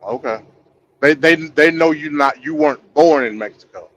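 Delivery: ordinary talk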